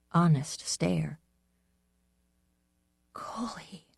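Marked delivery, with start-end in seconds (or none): 3.1-3.9 trembling, barely voiced "Coley"; shaky voice. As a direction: let the voice shake on "Coley". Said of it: "I said in a shaky voice".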